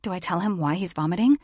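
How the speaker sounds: ordinary delivery, nothing marked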